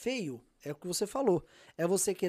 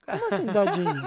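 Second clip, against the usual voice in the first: laughing; 8 semitones higher than usual. Real, not acted. Laughing at friend's word.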